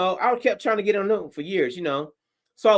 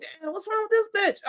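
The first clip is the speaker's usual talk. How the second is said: high, softer mock girlfriend outrage; voicing the girlfriend, baffled and high; girlfriend's disbelief; 11 semitones higher, 4 dB quieter than usual. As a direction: girlfriend whispering disbelief about the booker. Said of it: "She like".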